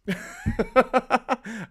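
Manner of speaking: high, loud burst of laughter. Real, not acted. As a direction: burst out laughing at your own slip. Laughs after misreading a chat.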